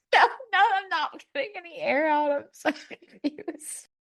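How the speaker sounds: high, laughing through the words; flustered giggly protest. Real, not acted.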